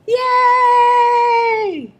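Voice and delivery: high, loud, long-held cheer; wild cheer. A narrator's reading. Elves cheering as Santa Claus appears, wild cheer. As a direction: cheer it out on one high, stretched note. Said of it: "The elves cheered wildly".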